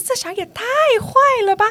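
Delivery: high incredulous outrage; indignant exclaim. Retold or imitated. Teacher venting about the lying child: high outraged exclaim. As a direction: cry out at the kid's lie, up high. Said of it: "我说".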